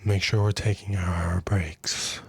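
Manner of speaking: slow, whispered reminder at the ear; slow whispered nag right by the ear. Retold or imitated. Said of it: "comes up to your ear and goes".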